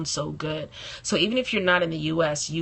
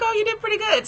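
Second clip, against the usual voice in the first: much higher, light mimic of the London woman; imitating the London friend; 4 dB louder, faster than usual. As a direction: quote the friend in a high, lightly put-on voice. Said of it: "She was like".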